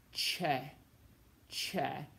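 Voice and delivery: che said with an English CH sound; soft C as CH. Shown as a demonstration. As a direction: pronounce che as "cheh". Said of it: "C before E sounds like".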